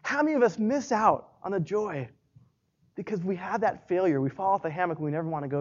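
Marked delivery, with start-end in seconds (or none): none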